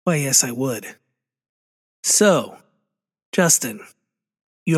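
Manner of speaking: lightly voiced solemn interview host; measured, formal host voice with deliberate pauses; James Lipton. Podcaster playing the interview host to a guest, lightly put-on solemn voice. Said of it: "fulfill your role as this podcast's James Lipton".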